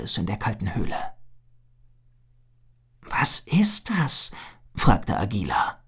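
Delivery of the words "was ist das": slow, breathless whispered question; awed whisper. Acted. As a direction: afraid to disturb the light. Approaching strange glowing light in cave.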